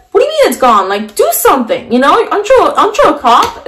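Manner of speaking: high, pleading protest at a useless cop; frantic daughter begging her dad to act; panicked disbelief. Retold or imitated. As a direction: cry out in disbelief, voice climbing high.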